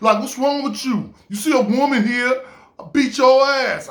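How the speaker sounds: high, loud threat; imitated fiery New York woman warning someone off; New York attitude. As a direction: square up like a tough New Yorker. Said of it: "that New York came after her".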